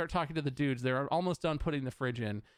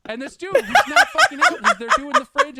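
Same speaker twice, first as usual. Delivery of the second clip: much higher, loud laughing rant; laughing outburst; 14 dB louder than usual. Real, not acted. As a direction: shriek it out, still laughing in disbelief.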